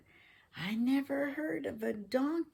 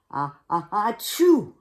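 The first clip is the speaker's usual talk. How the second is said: building sneeze, loud achoo; 3 semitones higher, 10 dB louder than usual. Shown as a demonstration. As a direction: wind up and sneeze. Demonstrating a sneeze.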